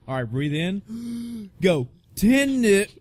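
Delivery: hammed-up gasp and yelp of a count before passing out; dramatic countdown. Patient hamming up his last count before going under.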